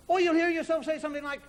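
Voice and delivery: usual speaking voice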